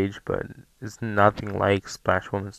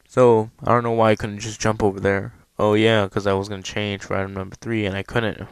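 usual speaking voice

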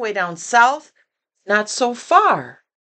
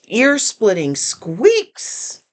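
usual speaking voice

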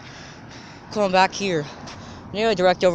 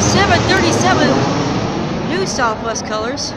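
panting after a run; winded, catching breath between words; 4 semitones higher, 7 dB louder than usual. Real, not acted. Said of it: "my out of breathiness".

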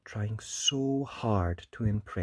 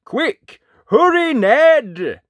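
high, loud, stretched yells; urgent shout; 13 semitones higher, 12 dB louder, slower than usual. A narrator's reading. Knights warning Ned of the dragon, urgent shouting. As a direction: shout a warning across the field. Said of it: "The knights all shouted".

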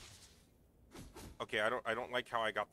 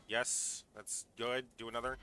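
ordinary talk, nothing marked

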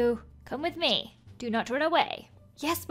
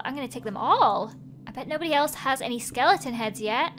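ordinary talk, nothing marked

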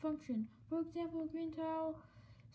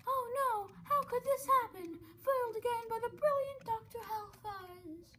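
higher, hammed-up villain lament; foiled villain; 7 semitones higher than usual. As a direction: ham up the thwarted bad guy.

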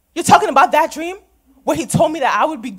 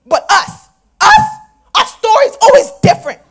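tearful outburst; 7 semitones higher, 8 dB louder than usual. Acted. Daughter breaks down crying while arguing with her mother. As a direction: voice cracking into sobs, pitched up and louder.

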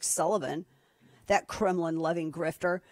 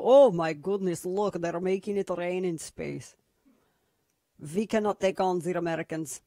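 put-on Russian accent. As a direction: play an awed Russian onlooker, thick accent. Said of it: "say my Russian accent's too good".

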